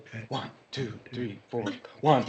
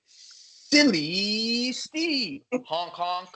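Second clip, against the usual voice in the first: silly clown; 2 semitones higher, slower than usual. Acted. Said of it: "emotional name game".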